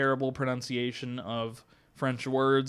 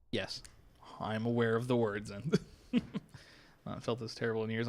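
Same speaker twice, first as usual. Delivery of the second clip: ordinary delivery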